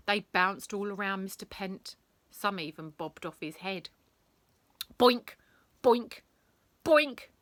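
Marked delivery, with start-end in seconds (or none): 5.0-7.3 loud high bellow of a frantic teacher. Acted. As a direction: bellow it, louder with each word. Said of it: "he bellowed".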